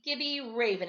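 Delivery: plain reading voice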